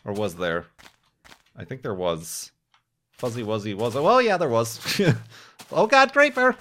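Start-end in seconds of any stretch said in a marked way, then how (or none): 5.7-6.6 loud, high-pitched real alarm; startled yelp. Real, not acted. Player spotting a creeper right by him, startled shout. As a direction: cry out as if suddenly ambushed.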